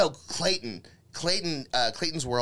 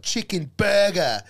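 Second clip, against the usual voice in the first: "Chicken burger." much louder, higher and slow; Clayton's catchphrase; 6 semitones higher, 7 dB louder, slower than usual. Retold or imitated. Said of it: "famous for going".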